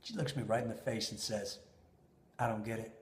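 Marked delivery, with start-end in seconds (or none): 2.4-2.9 stone cold. Retold or imitated. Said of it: "this is stone cold".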